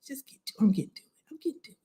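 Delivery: imitating the audience in a hushed whisper; mock whispered nudge to get to the point. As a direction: breathe it like an impatient aside. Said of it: "y'all like".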